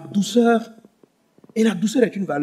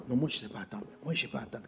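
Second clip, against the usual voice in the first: much softer, lower grumbling quote; mock impatient mumble; 8 semitones lower, 16 dB quieter, much faster than usual. Preacher imitates an impatient believer, low and muttered. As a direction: mutter it like someone who refuses to wait. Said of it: "quand il parle".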